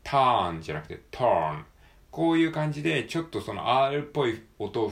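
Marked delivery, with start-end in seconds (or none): 0.0-1.6 first "turn" flat like katakana, then "turn" with a curled r; flat versus r-colored. Shown as a demonstration. Teacher contrasts a wrong "turn" with a right r "turn".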